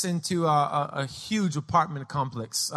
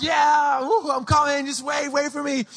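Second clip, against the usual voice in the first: put-on high, eager kid voice; excited boy; 8 semitones higher than usual. Five-year-old fireman calling to his sister, excited. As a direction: call out as a thrilled young rescuer.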